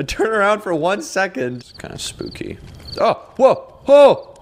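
loud startled whoa, high; startled shout. Real, not acted. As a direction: jump at the sight, shouting. Sees dude untie Bob.

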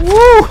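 high, loud "woo"; sudden high-pitched whoop; startled whoop. Real, not acted. Player jolted by the scary game, startled whoop.

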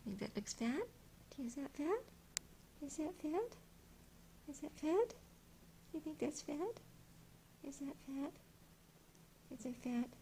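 high-pitched rising question to a pet; playful high pet talk; cutesy teasing. Pretend. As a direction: coo it high, lifting at the end. Teasing cat with repeated question.

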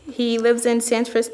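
ordinary delivery

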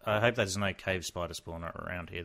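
ordinary delivery

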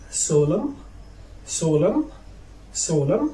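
"solemn" ending on m, rising; teacher's careful "solemn", final N left silent. Shown as a demonstration. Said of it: "you cannot pronounce it after M".

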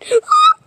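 sharp high gasp; surprised gasp. Pretend. A kid spots a strange reflection, sudden high gasp. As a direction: gasp at something startling.

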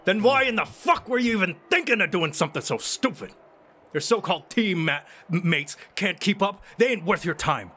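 father scolding his daughter for her teammates; angry father. Acted. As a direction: keep hammering at her. Father keeps yelling at daughter.

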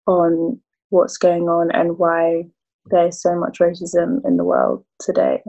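ordinary delivery

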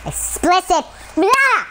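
high playful silly yelp; mock naughty voice. Youtuber teasing viewers, silly mock voice. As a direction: ham up the word like a joke. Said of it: "my videos will be ... more explicit".

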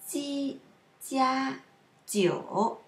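Ji and jia level, jiu dipping; sound demo. Shown as a demonstration. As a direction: separate Ji, jia, jiu with full tones. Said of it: "a few sounds which are different".

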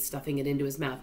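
plain reading voice

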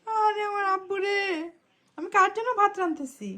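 high, fond coo to a sleeping son; doting mother. Real, not acted. Mother cooing over her son asleep on the floor, high and doting. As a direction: melt over the little one, pitched well up.

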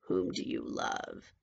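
very low, slow, soft witch; menacing witch. A narrator's reading. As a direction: question her deep and slowly. Witch interrogates princess.

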